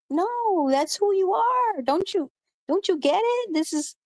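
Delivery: high, insistent and pleading; exasperated plea. Retold or imitated. Imagined reply urging him to accept.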